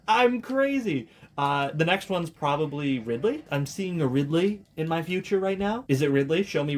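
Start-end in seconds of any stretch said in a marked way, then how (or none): 0.1-1.1 high laughing shout; laughing excitedly. Real, not acted. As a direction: laugh it out, amazed. Guessed right, amazed.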